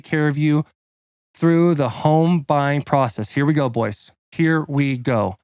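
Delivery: punchy, deliberate announcer-style read; sponsor ad read. Acted. Podcast guest reading a mortgage ad, punchy and deliberate. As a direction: sell it line by line, stressing key words.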